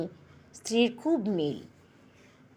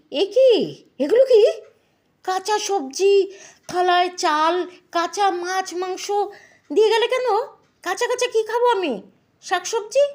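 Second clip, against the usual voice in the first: shocked outcry; 8 semitones higher than usual. Acted. Husband shocked at raw food.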